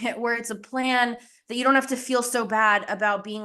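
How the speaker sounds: usual speaking voice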